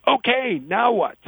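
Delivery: high-pitched comic friend back on the phone; mock-frantic, asking for instructions; panicked caller. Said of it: "the friend panics".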